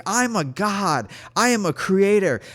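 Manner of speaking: high, insistent half-scream; retold screaming. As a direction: proclaim it at a mock scream. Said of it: "I was just screaming".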